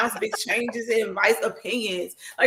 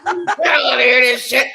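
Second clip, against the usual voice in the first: laughing, louder mock outburst; mock annoyed yell; 8 dB louder, slower than usual. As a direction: play the fed-up listener shouting through a laugh. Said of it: "They be like".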